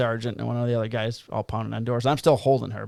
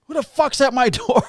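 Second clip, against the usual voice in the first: high, louder, annoyed snap at the door; irritated resident; 12 semitones higher, 5 dB louder than usual. Retold or imitated. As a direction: play a sleepy guest furious at the knock. Woken resident answering door.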